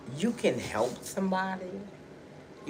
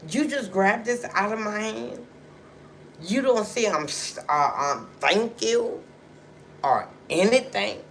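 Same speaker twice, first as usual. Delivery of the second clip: higher, louder voice of offended disbelief; retold indignant thought; 6 semitones higher, 6 dB louder than usual. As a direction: replay your silent outrage out loud. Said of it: "in the back of my head".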